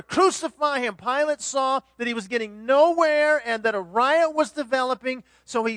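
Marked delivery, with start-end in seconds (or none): 0.0-1.0 loud high shout; loud crowd chant from the mob; mob roar. Acted. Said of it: "the mob roared even louder".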